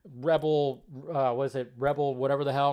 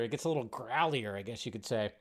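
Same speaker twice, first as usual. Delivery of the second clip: put-on low, much softer growly old-man rasp; Nick Nolte impression; 6 semitones lower, 9 dB quieter than usual. Shown as a demonstration. Host demoing an older voice, low and growly. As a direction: growl it low like a weathered actor. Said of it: "my Nick Nolte impression".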